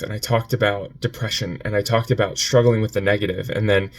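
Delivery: ordinary talk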